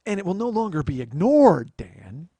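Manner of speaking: loud, rising hint of Glenn Close; hammed-up threat, then drop on "Dan"; Glenn Close menace. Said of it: "Glenn Close boiling a rabbit".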